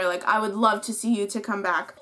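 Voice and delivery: ordinary delivery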